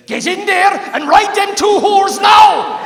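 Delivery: huge, slow, furious yell; furious shout. Retold or imitated. Wife screaming at her husband in furious yell. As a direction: bellow it like an outraged wife.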